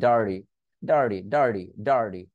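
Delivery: ডার্টি said "ডার্ডি". Shown as a demonstration. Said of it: "ডি বলে দেয়". (নিচু স্বরে ডার্ডি)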